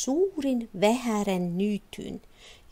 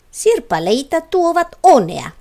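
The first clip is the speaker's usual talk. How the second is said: bright exclamation; 6 semitones higher, 11 dB louder than usual. A narrator's reading. Vivi speaks after the mirror shatters.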